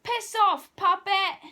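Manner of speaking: loud, high shout. Retold or imitated. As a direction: yell it back at him. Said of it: "and I was like".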